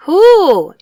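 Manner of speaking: high loud eager question; chorus question. Acted. Animals asking the bear, loud. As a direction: demand it together. Everyone asks together.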